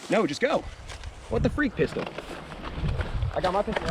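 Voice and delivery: loud, high: just go; urgent shout. Real, not acted. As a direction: push them out, loud. Urging teammate forward.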